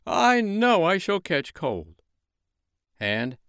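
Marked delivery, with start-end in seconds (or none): 0.1-2.0 higher, slow, louder fretful snowman; a sniffly snowman worrying; snowman worried. Acted. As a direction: snowman fearing a cold. Snowman after sneezing.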